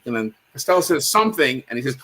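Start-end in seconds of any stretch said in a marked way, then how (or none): none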